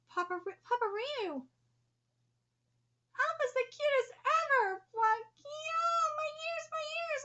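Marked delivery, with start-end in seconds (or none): 3.2-7.2 much higher, louder squeal; squealing gush. Acted. Uncle squealing about Alpha, high and loud. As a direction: squeal over the cute one. Gushing then pained.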